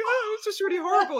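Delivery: high, fast mock wail; imitated sobbing. Comedian answering concerned couple, mock sobbing. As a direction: blurt it out in a hammed-up tearful squeak. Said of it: "I was just stood sobbing".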